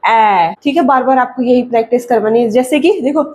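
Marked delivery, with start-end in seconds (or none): none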